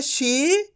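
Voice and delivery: शी with the ई held long; drawn long ii. Shown as a demonstration. Teacher models for students, stretched. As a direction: stretch शी clearly. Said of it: "तो शा को अपन कैसे पढ़ेंगे?".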